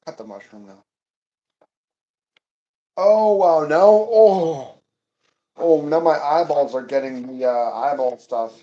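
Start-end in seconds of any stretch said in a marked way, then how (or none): 2.9-8.5 loud startled oh, wow, no; loud pained exclamations at stinging eyes; onion sting outcry. Real, not acted.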